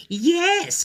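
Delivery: high, loud sly villain voice; scheming Mama Dinosaur. Acted. Mother dinosaur plotting against the child, sly high voice.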